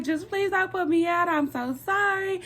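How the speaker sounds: high-pitched imitated begging; acted-out desperate apology; panicked pleading. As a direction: plead like a frantic kid in trouble. Acted plea to her mother.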